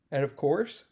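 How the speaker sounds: words stretched by a real yawn. Real, not acted. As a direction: say it mid-yawn, mouth wide open. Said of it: "got a yawn".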